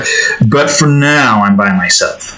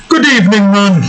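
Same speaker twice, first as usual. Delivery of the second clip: Jamaican accent; 8 semitones higher than usual. Shown as a demonstration. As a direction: greet them in a big Jamaican voice. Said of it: "just regular Jamaican".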